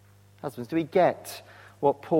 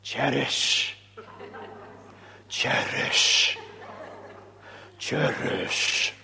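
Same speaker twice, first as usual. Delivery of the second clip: lilting Welsh vicar's cherish, drawn out slowly; Welsh accent; 3 semitones lower, 3 dB quieter, much slower than usual. Retold or imitated. Vicar blessing a wedding couple in a gentle Welsh accent. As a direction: imitate a Welsh vicar ending his sermon. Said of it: "in a lovely Welsh accent".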